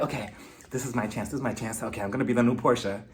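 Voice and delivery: hushed, low talk to oneself; scheming aside. Retold or imitated. Guest privately plotting her big moment, hushed and low. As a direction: mutter the plan under your breath.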